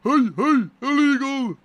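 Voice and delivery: high, loud mock protest; exaggerated high-pitched objection; Tom impression. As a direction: cry foul in a high, loud voice. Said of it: "Gonna sound like Tom soon".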